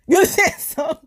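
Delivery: high, loud laughing question; cracking up while telling the story. Real, not acted. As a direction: burst out laughing as you ask.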